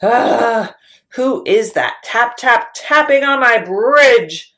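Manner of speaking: loud high troll bellow; troll roar. Acted. Troll bellowing at the biggest goat. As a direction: bellow it, furious. Troll roars third time.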